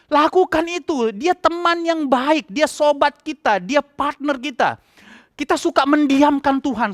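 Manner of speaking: high-pitched fervent preaching. Acted. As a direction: urge the congregation with full conviction. Preaching at full force. (khotbah berapi-api dengan nada tinggi)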